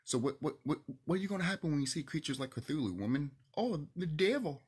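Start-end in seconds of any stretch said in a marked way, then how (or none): 3.6-4.6 higher, slow mocking exclamation; mock alarm. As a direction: imitate someone aghast at a creature. Mocks a woman's shocked reaction.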